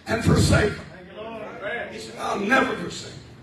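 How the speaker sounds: preaching at full force. Acted. Preacher promising the church God stays, shouted preaching. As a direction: build it to a roaring climax.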